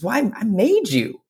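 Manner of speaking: high-pitched storybook maker; wood carver. Acted. Wood carver explains to Punchinello, high kindly voice. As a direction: answer him high and sure. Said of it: "And he says".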